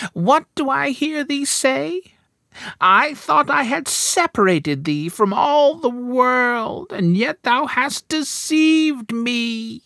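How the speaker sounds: high, slow furious witch voice; enchantress crying out. A narrator's reading. Enchantress finds out Rapunzel's secret, high slow furious cry. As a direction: shriek it high and drag it out in rage. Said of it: "cried the enchantress".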